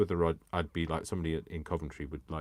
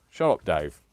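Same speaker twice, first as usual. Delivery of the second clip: loud mock telling-off; mock snap; 10 dB louder than usual. Telling off Dave, loud mock snap. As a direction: bark it at Dave.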